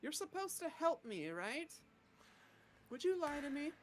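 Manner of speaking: high-pitched character voice; in-character questioning. Acted. Rakona questions her supposed helper, high in-character voice.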